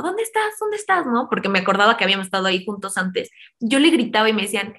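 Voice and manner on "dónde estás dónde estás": high, anxious calling out for him; high-pitched frantic calling; retold yelling. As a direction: yell out searching for someone. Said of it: "empecé a gritarle a este chavo".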